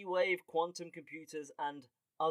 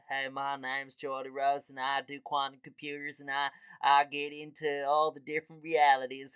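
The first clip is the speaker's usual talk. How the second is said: Geordie Rose; 5 dB louder than usual. Acted. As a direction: play a hammed-up folksy American introducing himself. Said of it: "he's not even from America".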